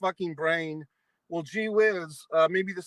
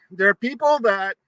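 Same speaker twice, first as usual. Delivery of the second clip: emphatic; 2 semitones higher, 8 dB louder than usual. Real, not acted. Ranting about absent friend.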